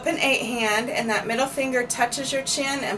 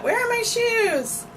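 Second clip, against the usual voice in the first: put-on whiny child; 10 semitones higher than usual. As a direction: whine it like a kid who can't find things. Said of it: "My children say that a lot".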